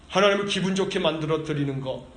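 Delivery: usual speaking voice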